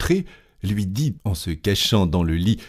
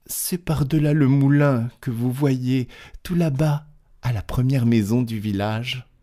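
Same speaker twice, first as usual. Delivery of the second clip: put-on a touch higher, eager child; little girl voice; 5 semitones higher than usual. To the wolf, a child lightly voiced. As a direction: point the way like a helpful little girl.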